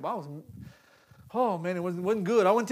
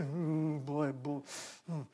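soft, low rueful sigh of words; hushed reluctant ooh boy; quiet uneasy groan; 6 semitones lower, 11 dB quieter, much slower than usual. Retold or imitated. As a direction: grumble quietly, caught out. Uneasy inner reaction.

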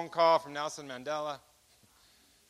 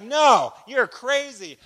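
loud, high, scoffing no; scoffing shout; 7 semitones higher, 11 dB louder, much slower than usual. Retold or imitated. Interrogator scoffs at the prisoner's offer, shouting. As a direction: brush him off with a raised, incredulous voice.

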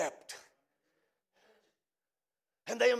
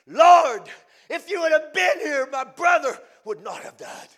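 loud, tearful cry of Mary; through tears; 6 semitones higher, 13 dB louder than usual. Acted. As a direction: call out to the Lord through tears. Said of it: "she said through her tears".

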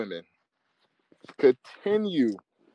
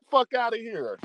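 usual speaking voice

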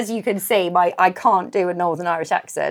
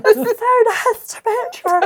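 put-on voice; 12 semitones higher, slower than usual. Joking about using voices on a date.

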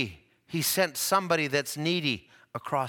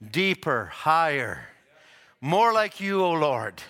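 a hushed prayer rising on more like you; 4 semitones lower than usual. Pretend. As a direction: pray it as a humble plea. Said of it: "But I say".

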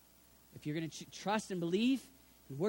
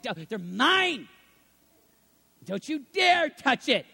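louder, slowed, clinging "Don't you dare touch it"; possessive loud warning; 3 semitones higher, 8 dB louder, slower than usual. Retold or imitated. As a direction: snarl it like guarding a treasure. Idol lover guarding his things.

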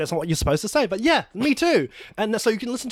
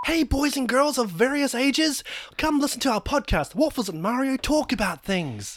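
kindergarten host voice; 5 semitones higher than usual. Acted. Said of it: "kindergarten style".

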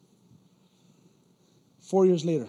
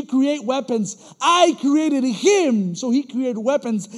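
loud, slow, emphatic reading, louder; scripture reading; 7 semitones higher, 10 dB louder, slower than usual. Acted. Preacher reading Isaiah aloud to the congregation, slow. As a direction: read the verse slowly like a proclamation.

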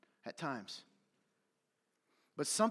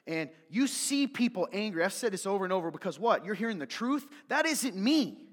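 normal talking voice

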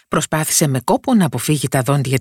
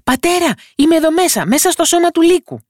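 very high, loud call to his father; small boy yelling for his father, much higher and louder; tiny boy calling out; 4 dB louder than usual. A narrator's reading. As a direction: shout for Dad from inside the wolf. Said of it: "φώναξε".

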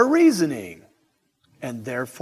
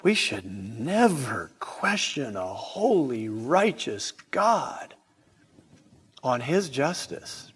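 hushed whisper; 4 semitones lower, 5 dB quieter, slower than usual. Real, not acted. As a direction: drop to a slow, breathy whisper. Solemn point about God's justice.

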